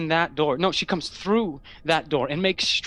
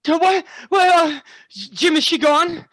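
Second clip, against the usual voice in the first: panicked, higher and louder; startled awake; 10 semitones higher, 7 dB louder than usual. Acted. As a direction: jolt awake in fright. Waking in panic from nightmare.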